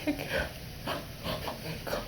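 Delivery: usual speaking voice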